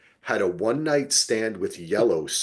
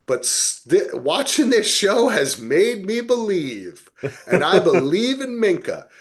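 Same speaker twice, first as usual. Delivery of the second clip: mock testimony; 8 semitones higher, 4 dB louder than usual. Mock-preaching devotion to actress.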